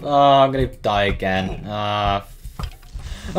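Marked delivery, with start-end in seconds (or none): none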